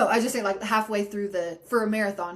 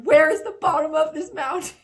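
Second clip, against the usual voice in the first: exasperated; 6 semitones higher, 6 dB louder than usual. Retold or imitated. Exasperated on endless switchbacks.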